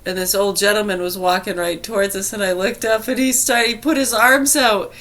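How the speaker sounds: ordinary delivery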